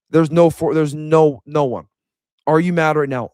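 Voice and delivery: normal talking voice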